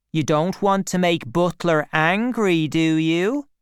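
a measured warning to an underling; coolly threatening young schemer. A narrator's reading.

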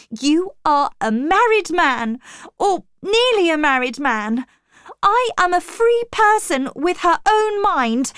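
high, loud, forceful defiance; indignant protest. A narrator's reading. Jane pushes back at Rochester's embrace, indignant. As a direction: declare it loudly, pitch climbing. Jane angrily refuses his advances.

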